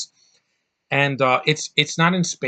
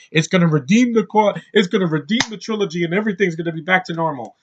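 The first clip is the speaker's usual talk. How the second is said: higher, louder sing-song mockery of naive fans; mocking hopeful fans; 5 semitones higher, 4 dB louder than usual. Vlogger mocking fans who expect episode nine to fix the trilogy, higher and louder. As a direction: parody their hopeful whining, fast at the end. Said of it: "asshats ... saying".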